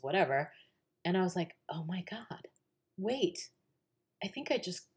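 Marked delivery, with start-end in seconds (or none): 1.7-4.9 hushed, halting disbelief; retold stunned disbelief. Daughter reading her acceptance letter, stunned and hushed.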